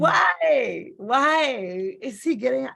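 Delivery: crying out to God in a tantrum; screaming at God. Retold or imitated. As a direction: plead upward in anguish, stretching the words. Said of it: "screaming at God".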